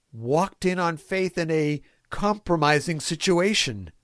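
ordinary talk

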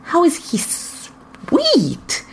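high, slow, drawn-out disbelief; incredulous. A narrator's reading. Barney questioning Robin about Moist: slow incredulous. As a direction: stretch each word in disbelief.

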